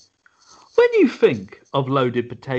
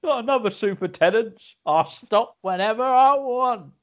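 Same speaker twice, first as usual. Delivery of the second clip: higher-pitched protesting drinker; drinking Chuckle Brother; 6 semitones higher than usual. Acted. Chuckle Brother turned drinker refusing to stop, high-pitched protest. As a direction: put on a high, defiant drinker's voice. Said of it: "just do go".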